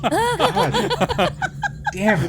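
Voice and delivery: high, loud laughing curse; laughing exclamation. Real, not acted. Game master laughing in disbelief at the dice.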